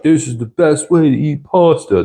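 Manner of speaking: high-pitched, hammy bad Italian accent; terrible Italian accent. Quoting a pasta documentary to partner, terrible Italian accent. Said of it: "That's my terrible Italian accent".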